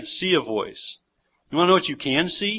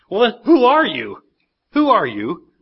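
exasperated, raised-pitch demand; acted-out frustration, louder on the first ask; frustrated questioning; 6 semitones higher, 5 dB louder than usual. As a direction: press the question with mounting impatience. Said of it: "You sense their frustration here".

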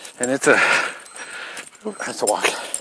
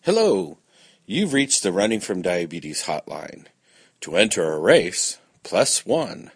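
automated hotline recording; phone menu voice. Acted.

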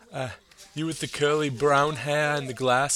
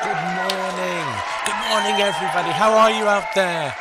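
loud, high host yell; shouting greeting; 5 semitones higher, 7 dB louder than usual. Acted. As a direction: call out to the whole crowd. Host greeting live audience.

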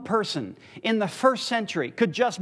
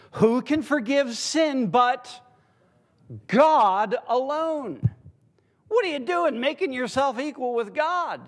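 raised pitch, mock-indignant questioning; indignant crowd's challenge; 5 semitones higher, slower than usual. Preacher voicing the people's response to Jesus, indignant challenge. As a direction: challenge it high, drawing out "God alone". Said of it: "the response of the people is what?".

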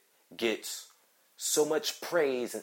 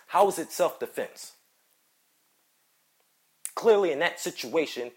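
normal talking voice

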